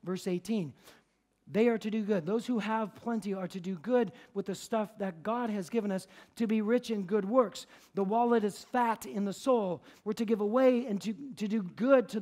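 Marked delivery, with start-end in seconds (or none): none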